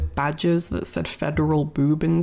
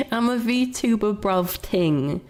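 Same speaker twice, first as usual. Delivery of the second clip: mock London roadman; 3 semitones higher, slower than usual. Repeats with more slang.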